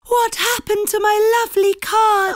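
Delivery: high, loud panicky cry; butterfly girl screaming in horror, high and panicky. A narrator's reading. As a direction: cry out in horror at the ruined cards. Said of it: "she asked in a panicky voice".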